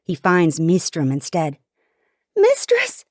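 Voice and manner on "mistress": very high-pitched cry; desperate yell. A narrator's reading. Princess calling to her companion being grabbed, yelling. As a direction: yell out for someone being seized. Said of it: "I yell".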